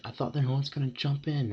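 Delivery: high-pitched mock yell; retold scream. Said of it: "just screams, like".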